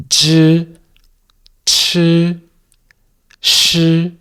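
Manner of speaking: zhi, chi, shi, each held long on a high level pitch; syllable demo. Shown as a demonstration. Teacher showing syllables that foreigners find hard, careful pronunciation demo. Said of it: "problems pronouncing certain syllables".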